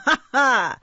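high, loud sly chuckle; sly old man's laugh; gloating chuckle. A narrator's reading. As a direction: chuckle at the boy's trouble.

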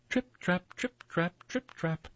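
high-pitched little trip-trap; light, high patter of tiny hooves; tiny goat steps. A narrator's reading. Youngest goat's tiny steps.

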